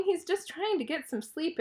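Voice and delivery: ordinary delivery, nothing marked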